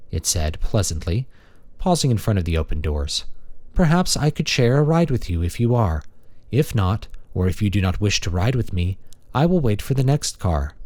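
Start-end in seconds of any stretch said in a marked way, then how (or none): 3.7-10.7 higher, polite feminine robot; a courteous maintenance machine; friendly robot. Acted. Robot character speaking.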